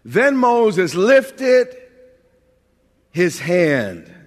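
each word drawn out slowly and weighted; dramatic slow scripture read. Shown as a demonstration. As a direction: read the verse very slowly, leaning on lifted. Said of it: "Verse eleven".

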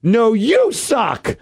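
put-on high, drawn-out kid's heckle; kid's mock yell. Kid yelling back at a drunk heckler, high mock yell. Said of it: "I hear this kid just yell".

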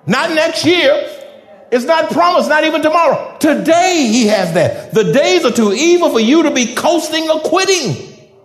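loud, high, fervent sermon delivery; fiery preaching. Acted. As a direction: preach it with full fire. Preaching at full force.

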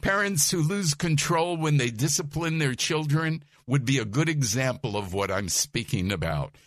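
usual speaking voice

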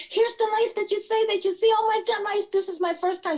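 high-pitched retold amazement at a first sighting; excited partner. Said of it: "she got so excited".

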